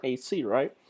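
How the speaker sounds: normal talking voice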